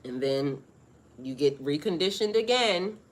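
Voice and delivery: normal talking voice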